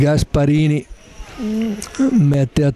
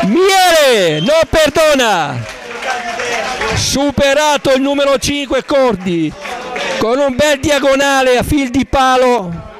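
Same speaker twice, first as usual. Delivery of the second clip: high, shouted goal call, fading down; breathless italian goal commentary; 8 semitones higher than usual. Real, not acted. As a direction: scream the goal, then ride it out. Commentator calls a goal live. (urlo del gol concitato, poi sfumando)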